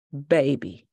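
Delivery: guard repeating the name in disbelief; flat repeat. Acted. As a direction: say it dead flat. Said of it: "voice flat".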